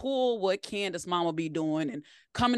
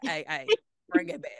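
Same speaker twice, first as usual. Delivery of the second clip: God reining her in; 5 semitones higher than usual. Retold or imitated. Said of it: "he'll be like".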